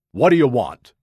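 loud, growled challenge; gruff growl. A narrator's reading. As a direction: growl it loudly at an unwelcome stranger. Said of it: "Amos growled".

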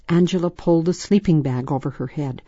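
ordinary delivery, nothing marked

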